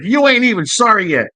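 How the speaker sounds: high-pitched mock threat; acted scolding. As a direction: imitate her telling him off. Woman scolding her crying man.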